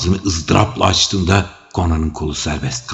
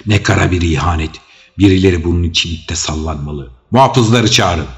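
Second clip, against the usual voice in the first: loud, raised, frantic lament; agitated outcry; 2 semitones higher, 8 dB louder than usual. Acted. Old adviser raging at the betrayal among the dead, frantic. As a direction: cry out in outrage and panic. Publius panics over corpses.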